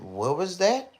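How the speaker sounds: put-on high singsong coo at a baby; mock baby-talk voice. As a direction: coo it up high like talking to a toddler. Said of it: "he said".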